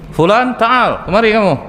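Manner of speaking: high, carrying call across the mosque. Acted. The Prophet calls the repentant man over, high carrying call. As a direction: summon him from a distance.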